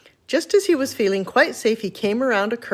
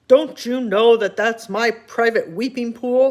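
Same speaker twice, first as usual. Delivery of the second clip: slow, louder rumbling rhinoceros; a big beast scolding slowly; deep angry voice; 4 dB louder, slower than usual. Acted. Rhinoceros scolding trespasser, slow angry rumble. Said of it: "said a deep, angry voice".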